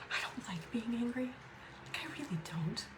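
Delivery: soft whisper, almost breathless; whispered confession. Real, not acted. Admitting to viewers she hates feeling angry, whispered. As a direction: let it slip out as a whisper.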